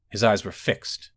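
ordinary delivery

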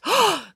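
sharp audible intake of breath; shocked gasp. A narrator's reading. Crowd hears April's revelation, shocked gasp. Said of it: "Everyone gasped".

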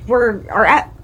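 stumbling over words; tripping on the words; tongue-tied. Real, not acted. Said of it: "I can't talk".

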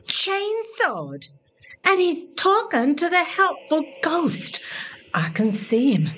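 usual speaking voice

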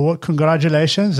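ordinary talk

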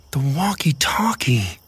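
breathy whispered realization; sudden whisper. Acted. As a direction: whisper it with a jolt of hope. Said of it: "I remembered with a jolt of hope".

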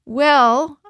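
a touch of flustered hesitation; nervous stammer. A narrator's reading. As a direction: stall nervously on the word. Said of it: "I stammered".

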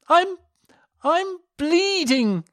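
high, slow frightened cry; terrified. A narrator's reading. Elisa confessing to her sister, terrified high cry. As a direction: force it out, scared.

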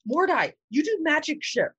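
high eager character voice. Acted. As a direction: pitch it up for the character. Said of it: "I've already lost the character voice".

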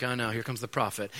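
dreading king. Retold or imitated. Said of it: "And Herod's like".

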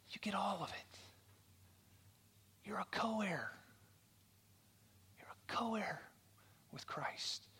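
soft, whispery preaching; intimate, softened appeal with long pauses; hushed and earnest. Real, not acted. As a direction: let the key truth land in a near whisper. Preacher's hushed climax of the story.

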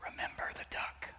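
hushed threatening whisper; sneaky little sister whispering a threat. Retold or imitated. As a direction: breathe it in his ear, no voice. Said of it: "she whispers".

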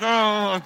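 strained high groan through a sock in the mouth; muffled groan. Retold or imitated. Pastor bracing while wife resets his shoulder, strained groan. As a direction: grunt as if biting down on a sock.

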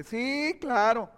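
mocking, drawn-out agreement; sarcasm. Shown as a demonstration. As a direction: say it with a little sneer of mockery. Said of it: "Como una burlita así".

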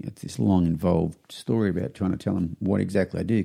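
normal talking voice

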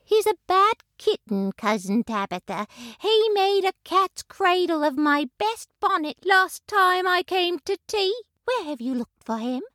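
high, brisk disapproving neighbor cat; a prim cousin scolding about the kitten; neighbor cat Ribby. A narrator's reading. Voices Ribby in story.

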